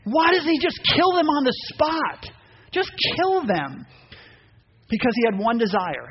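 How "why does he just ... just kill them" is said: exasperated. Retold or imitated. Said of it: "I'm thinking".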